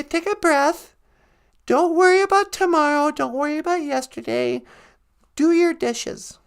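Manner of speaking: calm him down gently in the high character voice; Natasha voice. Acted. Natasha voice gives calm advice.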